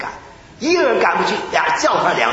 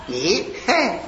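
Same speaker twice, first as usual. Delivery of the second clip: ordinary talk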